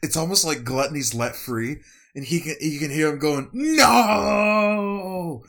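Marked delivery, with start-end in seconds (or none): 3.5-5.4 long, high, loud wail of no; anguished drawn-out cry; screaming. Acted. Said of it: "And as he's screaming".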